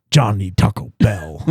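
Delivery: low put-on dimwit voice; mock caveman quote. Co-host teases Jonathan about eating, low mock caveman voice. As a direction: imitate a friend in low caveman talk. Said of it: "He said".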